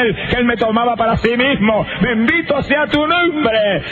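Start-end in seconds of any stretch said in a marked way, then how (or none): 2.0-3.8 high, slow, drawn-out cry of praise; ecstatic praise. Real, not acted. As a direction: lift the blessing up to heaven. Testimony exclaiming praise to God. (alabanza extática, aguda y lenta)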